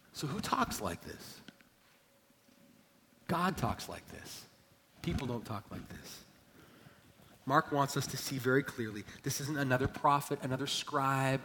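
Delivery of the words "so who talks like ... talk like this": much softer, measured preaching; quiet, spaced-out reflection; hushed solemnity. Real, not acted. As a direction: drop the voice right down for the weighty point. Preacher drops voice for solemn point.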